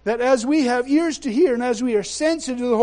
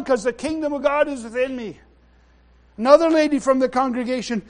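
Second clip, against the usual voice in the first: normal talking voice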